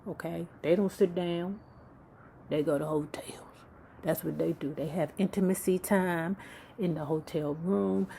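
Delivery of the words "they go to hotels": whispered like a secret; hushed gossip whisper. Shown as a demonstration. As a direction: lean in and whisper it.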